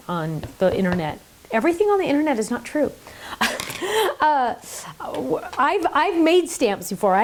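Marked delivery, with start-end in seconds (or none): 3.2-4.1 short high giggle. Real, not acted. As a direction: giggle up high at the silly idea. Laughing at internet myths.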